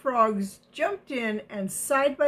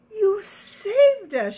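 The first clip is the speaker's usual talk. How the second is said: high, loud, slow cry of relief; grateful shout; 11 semitones higher, 7 dB louder, slower than usual. Acted. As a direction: shout it in joyful thanks. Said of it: "shouted the frogs".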